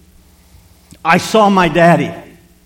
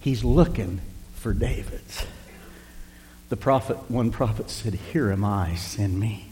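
lower, softer and slow, drawing them in; soft preaching; 6 semitones lower, 12 dB quieter than usual. Acted. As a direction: lean in and slow right down.

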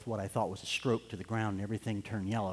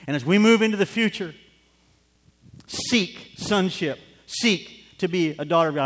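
much higher and louder, preaching; fervent shout; 6 semitones higher, 9 dB louder than usual. Real, not acted. Preacher exhorting the congregation at full force. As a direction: ring it out to the rafters.

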